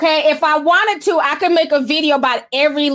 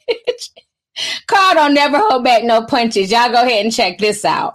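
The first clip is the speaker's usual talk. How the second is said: ordinary talk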